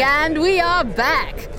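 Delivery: high gushing TV host; gushing red carpet chatter. Acted. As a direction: fawn over arriving guests.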